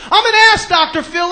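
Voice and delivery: high, loud mock confession; like he means it. Guest finally obeying TV host, emphatic shout. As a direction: shout it out with full conviction. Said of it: "Say it like you mean it".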